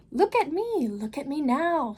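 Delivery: put-on the Cat in the Hat calming the children, big and upbeat. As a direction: reassure the kids with a grand flourish. Cat shows off.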